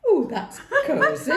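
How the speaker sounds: high laughing remark. Real, not acted. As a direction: laugh through it.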